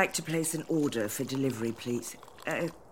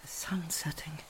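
whispering; 5 dB quieter than usual. Acted. Waking up alone, dazed.

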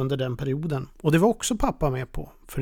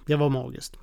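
usual speaking voice

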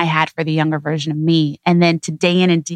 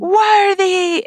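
mock toddler scream; 14 semitones higher than usual. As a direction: squeal it like a thrilled toddler. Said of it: "he just will start screaming".

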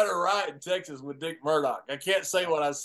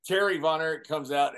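normal talking voice

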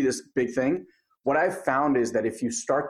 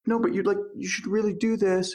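insistent guide; 7 semitones higher than usual. Retold or imitated.

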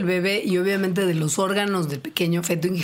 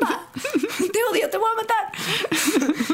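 much higher, laughing mock scream at dad; Eleven yelling at Papa; 12 semitones higher, slower than usual. Co-host acts out Eleven screaming at Papa, laughing. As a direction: ham up a furious kid screaming at her father. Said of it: "como le dice Eleven a Papa en Stranger Things".

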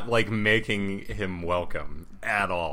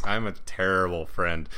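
ordinary delivery

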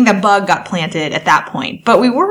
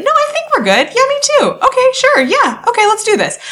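high-pitched, eager mock dialogue; acted pep talk. Partner voicing both sides of a confidence boost, high acted pep talk. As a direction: voice two friends hyping each other up, pitched way up. Acting out partners encouraging each other.

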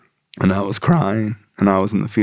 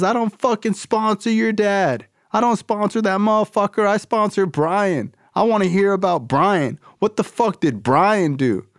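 much higher put-on voice of a blunt sponsor; tough sponsor voice; 11 semitones higher than usual. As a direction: bark out the tough-love lecture.

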